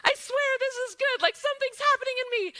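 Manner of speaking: crying but insisting it's good; tearful reassurance. Retold or imitated. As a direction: reassure them through happy tears. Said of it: "I am crying".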